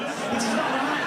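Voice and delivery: mock-appalled middle-aged woman, voice rising in volume; mock outrage. English woman back from Morocco tells her friend, mock outrage. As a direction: build to hammed-up disgust.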